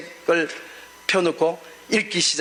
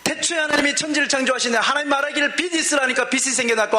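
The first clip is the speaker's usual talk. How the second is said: higher, brighter recital of the verse; lifted joyful reading; 6 semitones higher than usual. Retold or imitated. Pastor rereading Genesis to himself, higher and joyful. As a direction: read it lifted, tearful joy breaking through. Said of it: "그러면서".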